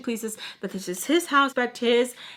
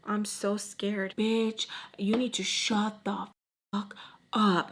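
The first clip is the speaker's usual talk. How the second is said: slow it right down, unimpressed; retold sarcastic taunt; 4 dB quieter, slower than usual. Rivals trading insults, slow sarcastic taunt. Quiet standoff, sarcastic comeback.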